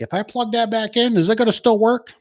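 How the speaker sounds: a lightly voiced fretful homeowner asking; worried customer. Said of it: "they're like".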